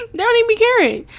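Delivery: high, loud and amused, right after a laugh; high tickled repeat after a laugh; amused emphasis. Real, not acted. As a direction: toss it off, laughing at herself. Laughing at her own overthinking.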